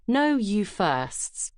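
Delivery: plain reading voice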